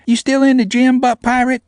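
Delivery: put-on high, loud teasing small girl. A narrator's reading. Namie mocking him over the gym intercom, high and loud. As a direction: needle him in a tiny high voice.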